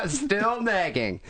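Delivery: amused echo, laughing. Real, not acted. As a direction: giggle the words out. Echoing joke, laughing.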